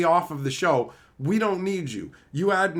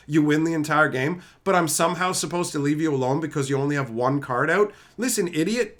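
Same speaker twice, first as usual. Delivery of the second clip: ordinary talk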